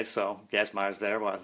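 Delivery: usual speaking voice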